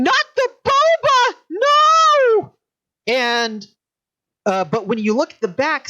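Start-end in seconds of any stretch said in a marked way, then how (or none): 0.0-2.5 high, loud panicked scream. Acted. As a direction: shriek in panic over the spilled boba. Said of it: "they're screaming".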